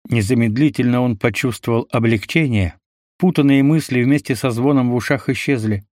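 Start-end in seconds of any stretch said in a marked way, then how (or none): none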